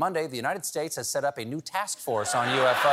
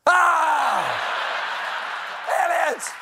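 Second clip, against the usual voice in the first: high piercing terrified shriek; panicked scream; 6 dB louder, much slower than usual. Pretend. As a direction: scream in mock alien panic. Mock panic at photo.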